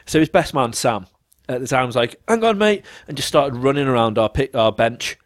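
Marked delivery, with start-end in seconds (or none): 2.3-2.8 high-pitched put-on urgency; acted, high "wait a moment" call; mock hurried stalling.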